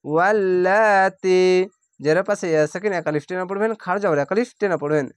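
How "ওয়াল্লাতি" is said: slow, drawn-out chanted recitation; Quranic recitation. Shown as a demonstration. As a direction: recite each word slowly with tajweed. Recited demo.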